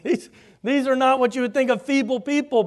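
ordinary talk, nothing marked

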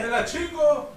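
grito fuerte y agudo de celebración; grito eufórico. Real, not acted. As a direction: gritarlo como brindis en fiesta. Brindis con tequila, gritando. (grito eufórico al brindar)

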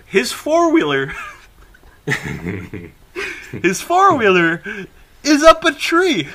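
high, loud, breaking into laughter; amused disbelief. Real, not acted. Telling friend about the four-wheeler's fate, laughing in disbelief. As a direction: deliver an absurd punchline through real laughter. Punchline of a funny story.

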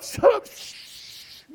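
high, slow whispered shush; whispered hush. Retold or imitated. Willie behind in the van hushing the argument, whispered shush. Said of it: "Willie's back there going".

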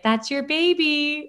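slow, lifted mock-Rachel line; Rachel from Friends. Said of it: "I felt like Rachel from Friends".

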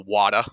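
"water" said as "wahta". Shown as a demonstration. Giving an example of Boston kids' talk, "water" as "wahta". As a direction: drop the R off "water", Boston-style. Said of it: "kids from the greater Boston area".

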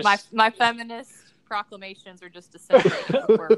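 loud laughing confession. Real, not acted. Laughing self-mockery.

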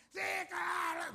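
high, slow mocking shout; loud sneering mock reply; sarcastic shout. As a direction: fling it out as scornful sarcasm.

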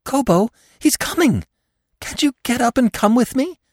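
squeezed, desperate high voice; frantic. A narrator's reading. Marina urging wounded Gobo to get up, frantic. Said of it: "she whispered frantically".

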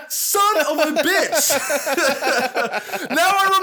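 high, loud laughing outburst of disbelief; laughing exclamation. Real, not acted. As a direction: laugh through the words at your own mistake. Realizes he was wrong, laughs.

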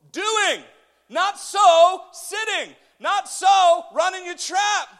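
high shout with heavy beats; full-force preaching. Real, not acted. Preacher warning idle churchgoers, high emphatic shout. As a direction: punch each phrase out loudly, pausing between.

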